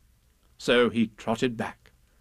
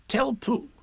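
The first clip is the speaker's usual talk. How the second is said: Rabbit's urgent aside; 12 semitones higher than usual. Acted. Rabbit passes on a message.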